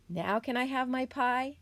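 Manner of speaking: high, rising hopeful question; young possum asking. A narrator's reading. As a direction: ask mom in a little voice. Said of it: "Joe asked his mother".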